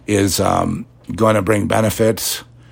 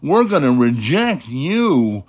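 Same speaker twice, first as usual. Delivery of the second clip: slow, higher, sneering mock piety; mocking imitation; 5 semitones higher, slower than usual. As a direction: parody them, drawn out and raised. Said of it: "you dare say".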